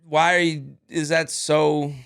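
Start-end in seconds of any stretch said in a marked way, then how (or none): none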